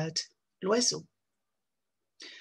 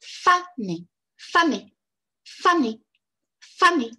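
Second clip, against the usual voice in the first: "Faner" with FA higher and louder, ner small; stress on FA; 5 semitones higher, 5 dB louder, slower than usual. Shown as a demonstration. Teacher showing the same rhythm as monkey on "Faner". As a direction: lead with FA in "Faner", then drop. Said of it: "le même schéma rythmique".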